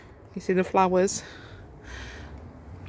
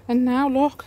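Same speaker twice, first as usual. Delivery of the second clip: proud excitement; 7 semitones higher, 6 dB louder than usual. Real, not acted. Proud reveal of cleared plants.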